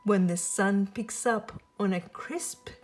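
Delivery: ordinary delivery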